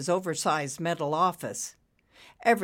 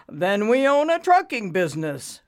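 loud, higher, untroubled statement; matter-of-fact; 5 semitones higher, 7 dB louder than usual. A narrator's reading. Banker answering desperate borrower, matter-of-fact. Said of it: "Ricky said matter-of-factly".

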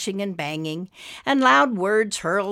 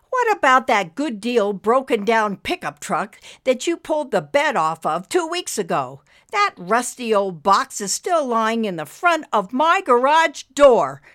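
loud, raised, snapped complaint; snapping; 5 semitones higher, 6 dB louder than usual. A narrator's reading. Wife scolds husband about rusty truck, snapping. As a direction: snap at him, punching up to the end. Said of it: "Marissa snapped".

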